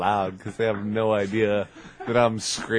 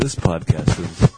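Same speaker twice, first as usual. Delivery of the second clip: slow, booming announcer voice; 3 semitones lower, 12 dB louder, slower than usual. Acted. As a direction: announce it like a trailer. Dramatic podcast intro.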